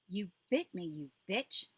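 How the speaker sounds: loud angry outburst; furious yell. A narrator's reading. Mother bitten by her daughter, furious loud yell. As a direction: snap back in sudden rage.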